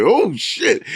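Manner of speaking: high, shocked oh shit; a kid's mock-shocked oh shit. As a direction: gasp it out as the fangs appear. Kid sees the vampire's fangs.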